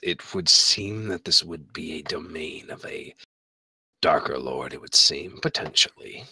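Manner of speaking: low, quiet menacing priestess. Acted. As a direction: speak low and cold as the fish priestess. DM voices priestess.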